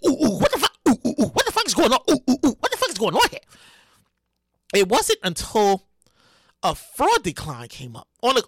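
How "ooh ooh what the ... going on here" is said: fast, baffled ooh ooh; bewildered staff; confused workers. Retold or imitated. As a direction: ramble in panicky confusion. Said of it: "They don't say".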